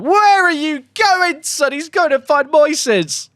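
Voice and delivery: high, loud, slow bewildered shout; incredulous yell. Real, not acted. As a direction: shout in disbelief at his winger. Player runs off oddly.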